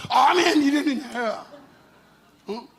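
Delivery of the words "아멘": loud, raised amen; preacher showing the congregation how to say amen; shouted amen. Shown as a demonstration. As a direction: belt out the amen. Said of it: "왜 손을 들고".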